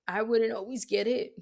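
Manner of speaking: ordinary talk, nothing marked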